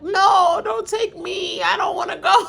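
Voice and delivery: high, hammed-up sobbing plea; fake-wail it like she's being taken; mock crying plea. Carolyn begging not to be taken, mock crying. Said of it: "She like".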